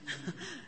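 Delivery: a high, soft burst of delighted laughter; joyful giggle. Real, not acted. Preacher giggles with joy talking about Jesus. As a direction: let a happy giggle slip out.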